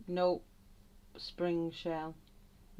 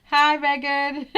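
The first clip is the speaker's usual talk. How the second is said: a big, drawn-out hello to a friend; loud excited greeting; 9 semitones higher, 17 dB louder, slower than usual. Real, not acted. Greeting a lurking viewer in chat with a loud, delighted hello. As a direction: call out loud and slow, delighted.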